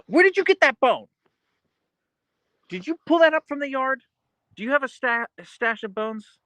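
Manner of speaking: high, sing-song pet voice. Real, not acted. Asking her husky about his bone in high baby talk. Talking to her dog Archer.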